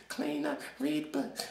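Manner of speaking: ordinary talk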